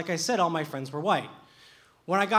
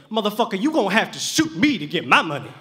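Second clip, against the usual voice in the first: defiant [name]; 2 semitones higher, 6 dB louder than usual. Retold or imitated. [name] retelling his defiance.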